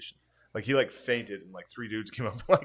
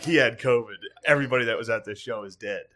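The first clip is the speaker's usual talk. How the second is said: mock announcement; 2 semitones higher, 8 dB louder than usual.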